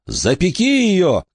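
high, slow commanding shout. A narrator's reading. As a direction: cry out the command. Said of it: "крикнул он".

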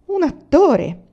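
loud and high, flung out with pride; proud exclamation. Acted. Emanuelson, asked what he is, loudly declares himself an actor. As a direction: proclaim "Un attore" like a stage announcement. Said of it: "esclamò".

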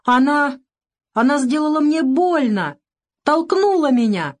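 high, wailing complaint; tearful little boy. A narrator's reading. Boy tells doctor his mother pushed him, wailing. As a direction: drag out the hurt like a crying child.

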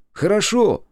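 high, loud, instant "хорошо"; exasperated agreement. Acted. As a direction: agree impatiently at high pitch. Boss agrees to higher wage. (громко, раздражённо соглашаясь)

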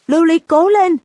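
high, loud chant like a game-show crowd; cheering shout. A narrator's reading. Class cheering at the screen for their friend, loud high shout. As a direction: yell it out to cheer her on. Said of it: "vung tay và hét vào màn hình".

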